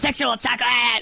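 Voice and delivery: hammed-up crowd-rousing cry; mock stage shout. Host jokingly introducing Sexual Chocolate to co-hosts, hammed-up shout.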